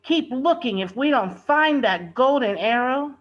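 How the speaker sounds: high, loud frustrated order; exasperated foreman pushing the crew. A narrator's reading. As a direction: snap at the men to keep searching.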